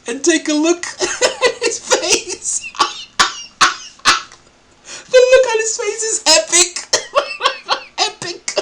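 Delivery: high-pitched, helpless real laughter through the words; laughing hard. Real, not acted. Host points viewers to the man's face, laughing hard. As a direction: barely get it out for laughing. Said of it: "It's absolutely funny".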